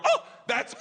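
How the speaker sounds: very high, bright squeal of a win; excited calling out. Retold or imitated. Wife in the back corner realizes her ticket won. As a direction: call out gleefully from the back.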